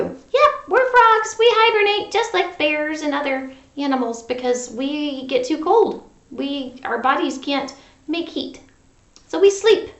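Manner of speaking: Froggy puppet voice. Pretend. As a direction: explain as the puppet frog.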